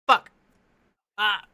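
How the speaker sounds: usual speaking voice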